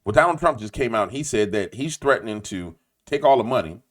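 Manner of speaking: ordinary delivery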